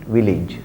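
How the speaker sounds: village said softly as VIL-ij; low, soft village with a short "ij" ending; dictionary pronunciation. Shown as a demonstration. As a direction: village with a short i in the second syllable. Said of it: "If you refer to the dictionary".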